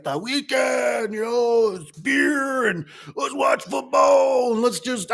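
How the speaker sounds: high, slow, cartoonish carefree voice; mock weekend-goer. Mocking average people to his viewers, high and drawn out. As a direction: ham up the lazy weekend crowd.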